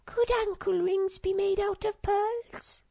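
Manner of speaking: put-on high, slow, dreamy girlish voice; Snork Maiden voice.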